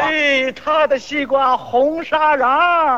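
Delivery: slow, drawn-out hawker's call; mock vendor's cry. Seller boasting about his rival's melon, drawn-out vendor's cry. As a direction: stretch it out like a street seller. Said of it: "互相吹".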